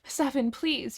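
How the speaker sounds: high-pitched desperate plea; crying out. A narrator's reading. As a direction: cry out a desperate appeal. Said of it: "you cry".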